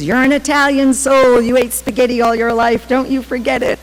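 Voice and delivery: high, loud mock scolding; scolding mother. Italian mother retorts to devotee daughter, indignant scolding. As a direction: set her straight as an indignant mother.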